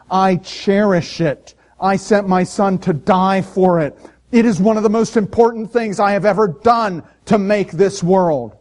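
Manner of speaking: slow, loud and high, a booming God. Acted. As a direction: proclaim it like God declaring his love. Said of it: "God says".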